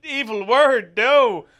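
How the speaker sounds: high, loud mock horror; drawn-out scandalised American outrage; shocked American viewer. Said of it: "people in, in America watching this going".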